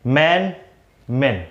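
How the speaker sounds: normal talking voice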